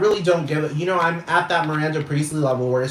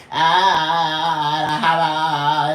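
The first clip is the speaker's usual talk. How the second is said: mock moaning; much slower than usual. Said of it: "she's all like".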